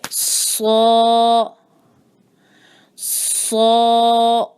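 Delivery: سو held long with a heavy ص; long heavy saad. Shown as a demonstration. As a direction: draw out سو, long and thick. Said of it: "تو اس کو ہم تھوڑا لمبا کریں گے". (سو لمبا اور بھاری)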